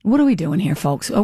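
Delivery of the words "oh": ordinary talk, nothing marked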